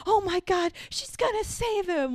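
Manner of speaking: high-pitched, breathless imitation of kid excitement; hyped kid. Kid watching the rescue, imitated hyped excitement. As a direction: gush like a thrilled young viewer. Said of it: "I remember just feeling so hyped".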